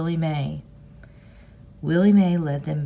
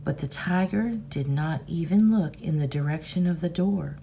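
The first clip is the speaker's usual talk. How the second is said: ordinary delivery, nothing marked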